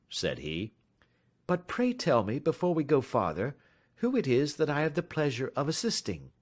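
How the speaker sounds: polite and in command; composed detective. A narrator's reading. Detective inviting the stranger to talk, lightly voiced calm.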